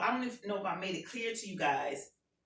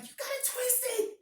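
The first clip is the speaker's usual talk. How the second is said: indignant protest; 13 semitones higher than usual. Retold or imitated. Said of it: "I'm like".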